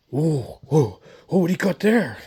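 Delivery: high, hyped-up "whoa" from a stranger; excited stranger. Retold or imitated. Nosy stranger at Goodwill eyeing the CD changers, mock excited. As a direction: play the nosy guy who spots a find.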